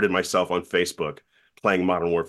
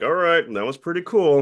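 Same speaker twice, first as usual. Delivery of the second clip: grudging teachers; 9 semitones higher, 3 dB louder than usual. Retold or imitated. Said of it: "teachers that hated me were like".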